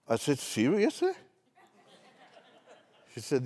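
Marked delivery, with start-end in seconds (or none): 0.4-1.1 high-pitched teasing disbelief; mock surprise. Pastor teasing the short lady about her daughter, mock shock. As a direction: feign shock, pitch leaping up.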